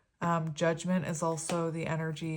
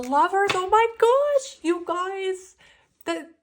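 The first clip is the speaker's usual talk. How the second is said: high, loud burst of delight; real thrilled "oh my gosh"; excited squeal; 14 semitones higher, 10 dB louder, slower than usual. Real, not acted. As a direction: squeal over the card you just flipped. Card reveal, thrilled reaction.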